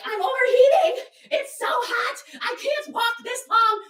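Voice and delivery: very high, shouted complaint; mock wailing. Exhausted walker complaining to guides, mock wail. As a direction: imitate a melodramatic, overheated whiner, dragging the end.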